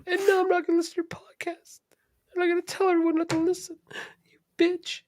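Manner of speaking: high whiny mock voice of an upset fan; mocking offended listener. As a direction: squeak it out like an overgrown child. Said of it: "be like".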